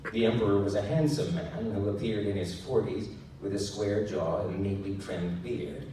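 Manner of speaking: usual speaking voice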